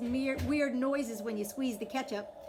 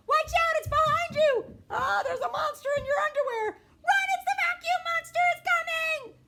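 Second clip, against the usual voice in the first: mock alarm; 9 dB louder than usual. Little sister warning her siblings, in high mock panic. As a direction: shriek it like a monster is right there.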